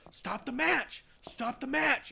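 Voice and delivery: high, strained mock plea from ringside; Jimmy. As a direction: imitate frantic calling without real volume. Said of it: "hear Jimmy on the outside telling him".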